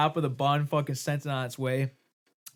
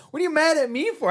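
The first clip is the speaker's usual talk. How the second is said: mock high protest; 5 dB louder than usual. Retold protest to his friend.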